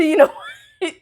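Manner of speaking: laughing it out high, half giving up; you know what, said through a laugh; laughing exasperation. Retold or imitated. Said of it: "I'm done".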